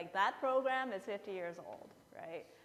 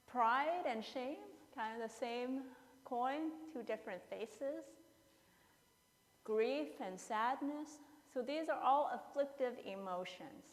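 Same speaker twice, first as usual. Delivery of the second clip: usual speaking voice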